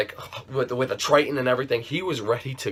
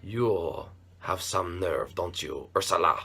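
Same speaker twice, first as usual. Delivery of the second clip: menacing; 9 semitones lower, 6 dB quieter than usual. Acted. Menacing address to boss.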